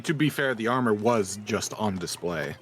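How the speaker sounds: ordinary delivery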